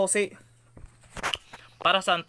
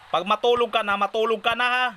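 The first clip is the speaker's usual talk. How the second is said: higher, louder pretend order; mock stern scolding; 9 semitones higher, 4 dB louder than usual. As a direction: order the baby to sleep. Ordering baby to sleep.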